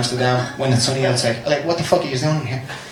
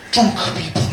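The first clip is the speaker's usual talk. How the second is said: usual speaking voice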